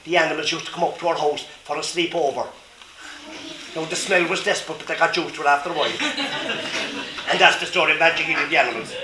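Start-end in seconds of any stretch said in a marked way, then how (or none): 6.0-7.2 soft laugh. Real, not acted. Storyteller laughs.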